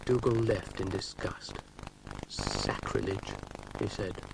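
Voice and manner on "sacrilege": disgusted. Acted. Dougal disgusted.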